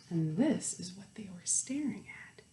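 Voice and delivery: teacher whispering a secret to the class; whispered aside. Real, not acted. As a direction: let them in on the joke in a whisper.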